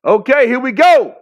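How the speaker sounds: high, loud kickoff holler; big hyped-up call; excited shout. Real, not acted. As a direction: shout it out to start the show. Calling the start of the event.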